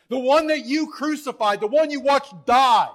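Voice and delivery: full-force preaching, high and loud. Real, not acted. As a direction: proclaim it as a loud accusation to the crowd.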